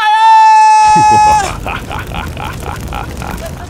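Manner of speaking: high, loud shrieking cackle. Acted. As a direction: let out a wild shriek. After shouting segment title.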